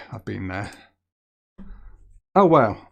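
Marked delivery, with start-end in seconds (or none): 2.3-2.9 surprised wow. Real, not acted. Surprised by close guess.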